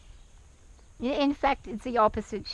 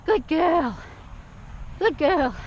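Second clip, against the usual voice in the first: bright praise; 5 semitones higher, slower than usual. Real, not acted. Proud praise after head down.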